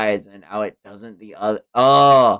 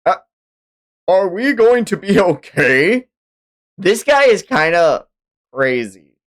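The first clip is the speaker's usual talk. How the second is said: nervous character; 7 semitones higher, 5 dB louder than usual. Acted.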